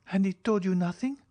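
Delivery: put-on quick, high young woman's question; pressing question. Julie presses the count quickly, high questioning voice. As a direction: fire it back fast.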